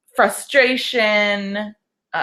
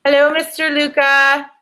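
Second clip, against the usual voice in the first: greeting son; 7 semitones higher, 5 dB louder than usual. Real, not acted. Mother greeting her son who just came home, sing-song.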